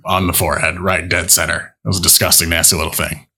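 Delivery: put-on a gruff old midwife, unimpressed. GM voices the midwife.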